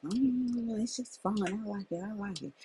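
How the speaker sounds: a hint of a carefree teen shrugging off advice; mocking teen daughter. Daughter brushing off mom's warning.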